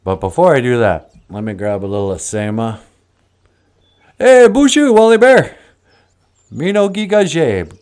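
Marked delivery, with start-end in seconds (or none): 4.2-7.8 loud, high, bright hello; cheery loud greeting. Real, not acted. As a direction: call out a happy greeting. Greeting the bear loudly.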